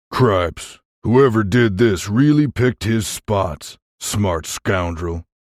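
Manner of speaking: slow, drawn-out western grumble; frustrated giant. A narrator's reading. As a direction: drag the words out in frustration. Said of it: "snapped his fingers in frustration".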